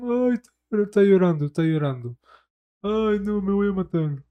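hammed-up self-pitying wail; mock crybaby whine. Mocking the ex who threatens to kill himself, in a whiny voice. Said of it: "apenas lo funan".

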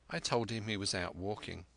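plain reading voice

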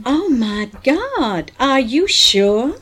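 slow, high and loud amazement; breathless with the oil news; excited gasp. Acted. Hearing of huge oil find.

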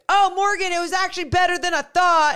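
high, loud mock quote; hammed-up quote of a friend; imitating friend. Quoting Deuce after pod.

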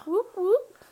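high whooping cheer; gung ho whoop. Acted. Cheering the show start.

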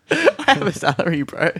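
high-pitched, laughing mock protest; amused retail-worker comeback. Quoting a viral retail guy to friends, laughing mock indignation. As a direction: imitate an exasperated worker, cracking up in high pitch.

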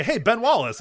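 high, loud hail across the lobby; calling out. Pretend. Fan hailing the player in the hotel, high call. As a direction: call out his name to grab him.

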